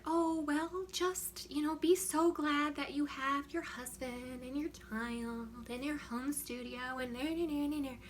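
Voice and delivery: high, drawn-out patronising coo; mock condescending. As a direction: imitate a sickly-sweet Pollyanna talking down. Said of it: "when you condescend to someone like that".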